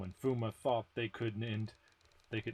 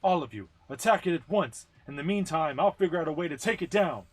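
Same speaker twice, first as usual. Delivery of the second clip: higher, louder commander urging the team on; rally the team with urgent orders; Fuma commanding; 5 semitones higher, 7 dB louder, faster than usual. Acted. Voices Fuma giving orders.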